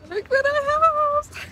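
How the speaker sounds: high, drawn-out excited squeal. Real, not acted. Showing viewers the new passport, excited squeal. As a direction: squeal it out, stretching the words in delight.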